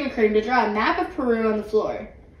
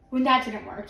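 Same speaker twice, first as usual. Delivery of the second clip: plain reading voice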